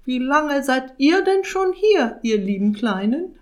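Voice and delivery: high, loud mock-sweet witch voice; falsely sweet witch. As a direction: coo at the little pets with fake kindness. Witch feigns sweetness to pets.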